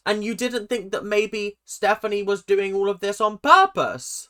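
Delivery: high, loud indignant challenge. Acted. Indignant retort to clip speaker.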